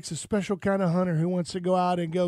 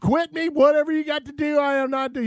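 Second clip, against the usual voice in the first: high, loud mock protest; acted defiant refusal; 8 semitones higher, 6 dB louder than usual. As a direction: refuse emphatically, pitched right up. Acts out flat refusal to boss.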